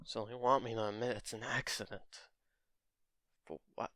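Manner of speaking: soft, low muttered to himself; bitter inner thought. Retold or imitated. Izuku silently questioning the accident paperwork. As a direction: keep it inside, quietly bitter. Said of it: "in his mind he goes".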